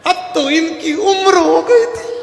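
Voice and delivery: very high, loud fake sobbing wife; mock tearful wail. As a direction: wail it out in exaggerated grief. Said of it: "पत्नी रोते रोते बोली". (रोने का नाटक, ऊँची आवाज़ में)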